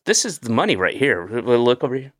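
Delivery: ordinary talk, nothing marked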